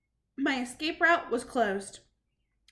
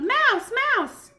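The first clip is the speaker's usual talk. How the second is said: shouting alarm; 12 semitones higher, 9 dB louder than usual. Acted. Imagined witch cries.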